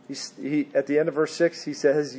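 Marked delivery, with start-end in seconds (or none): none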